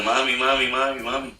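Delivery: high, carried-away cry of mami; calling out. Retold or imitated. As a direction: call out to her as if she can't hear. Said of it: "empecé a, a gritar". (gritando mami, llamando a su madre)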